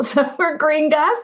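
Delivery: amused, laughing through the words. Real, not acted. Speaker telling the host about her cousins' nickname, laughing. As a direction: laugh at being called gringas.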